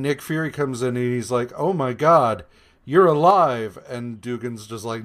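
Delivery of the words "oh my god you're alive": loud, high gasp of disbelief; as if seeing a ghost walk in; shocked disbelief. Retold or imitated. Fury stunned the captains survived, loud shocked cry.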